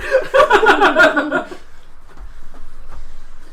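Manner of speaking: loud, high hysterical laughing fit; helpless laughter. Real, not acted. Friends at the game table cracked up, real hysterical laughter. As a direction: break down laughing, long and loud. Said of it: "You, you broke [name]".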